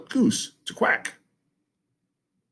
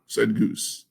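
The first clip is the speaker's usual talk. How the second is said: ordinary delivery, nothing marked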